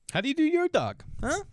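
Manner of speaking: high, incredulous challenge; squeaky mock interrogation; mock incredulous question. Mock questioning hot dog fans.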